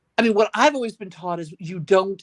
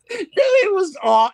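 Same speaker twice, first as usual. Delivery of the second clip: laughing; 12 semitones higher, 6 dB louder, slower than usual. Real, not acted. Laughing fit.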